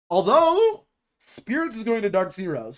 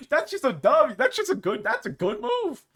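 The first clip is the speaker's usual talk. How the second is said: excited; 10 semitones higher than usual. Real, not acted. Praising the move.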